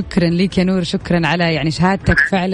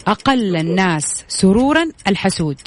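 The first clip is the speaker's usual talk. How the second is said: slow careful dictation; slower than usual. Shown as a demonstration. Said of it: "ركز معايا".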